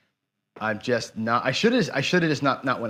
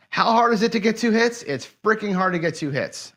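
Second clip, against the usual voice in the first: high-pitched, incredulous complaint; exasperated outburst; 7 semitones higher than usual. Real, not acted. Gambler complaining about missing two hits, exasperated. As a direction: pitch it up in real frustration.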